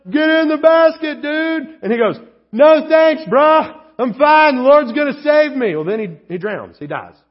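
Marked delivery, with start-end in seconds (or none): 0.1-1.4 higher and louder call from above; hollering down. Retold or imitated. Helicopter crew hollering down to the man, loud. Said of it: "they're hollering down". 2.5-5.7 higher and louder, cocky; shouted refusal. Retold or imitated. Man in the water shouting up at the helicopter, cocky.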